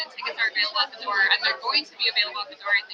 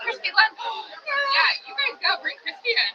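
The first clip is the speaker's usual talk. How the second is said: excited surprise; 13 semitones higher than usual. Real, not acted. Spotting a friend arriving.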